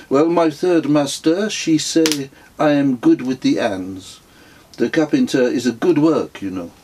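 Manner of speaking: a gentle, contented guru explaining his plans; a hint of a placid holy man; Indian mystic voice. A narrator's reading.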